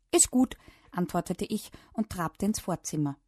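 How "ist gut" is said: higher, louder quick answer; called-out reply. A narrator's reading. Child answering mum's packing orders, called out. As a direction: call back in agreement. Child calls agreement to mum.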